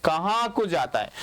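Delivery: normal talking voice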